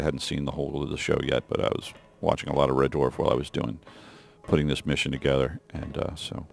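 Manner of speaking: talking softly, almost under breath; quiet. Real, not acted. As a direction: keep it hushed and low. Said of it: "I'm not exactly sure why I'm being so quiet".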